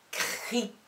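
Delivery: "Cric" with a pause before the final K; separated final consonant. Shown as a demonstration. Teacher restarting the syllable, final K set apart. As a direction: detach the last C of "Cric". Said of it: "Je recommence".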